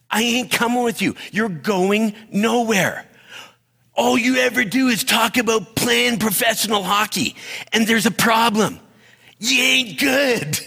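high-pitched, emphatic put-down, acted out; mock scolding. Girlfriend rejecting Happy on the intercom, mock scolding. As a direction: imitate a girlfriend laying down a hard truth.

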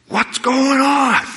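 high, slow, drawn-out indignant demand. Retold or imitated. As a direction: stretch it out, way up high, fed up. Angry confrontation at the bank.

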